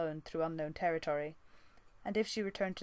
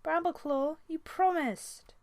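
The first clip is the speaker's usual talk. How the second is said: high, louder and slow hurt wail; 11 semitones higher, 6 dB louder, slower than usual. Acted. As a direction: stretch it out, betrayed and pleading. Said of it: "she wailed".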